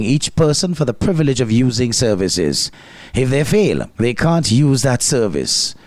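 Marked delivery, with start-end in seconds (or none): none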